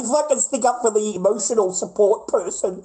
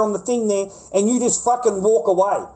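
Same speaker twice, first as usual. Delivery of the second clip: ordinary delivery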